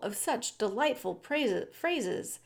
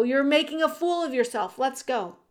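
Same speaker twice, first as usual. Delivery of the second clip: raised, louder brotherly reproach; impatient scolding; 5 semitones higher, 5 dB louder, faster than usual. A narrator's reading. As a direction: chide him and hurry him along. Brother hurrying and scolding Despereaux.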